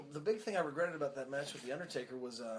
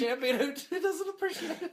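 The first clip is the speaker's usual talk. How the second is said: laughing; 13 semitones higher, 6 dB louder than usual. Real, not acted. Laughing, mock voice.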